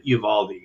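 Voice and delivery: "Uvalde" as you-VAL-dee, trailing down softly; YOU-start pronunciation. Shown as a demonstration. Passing on the way he heard it, YOU-start pronunciation. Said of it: "that's the way I've heard it".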